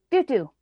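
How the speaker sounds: "Doo doo" high and loud, for cookie; child's mispronunciation. Retold or imitated. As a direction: mimic a kid's "Doo doo" attempt at cookie. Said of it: "And he would say, like".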